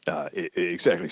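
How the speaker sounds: sputtering after water went down wrong; choking, trying to get words out. Real, not acted. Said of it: "water went down the wrong hatch".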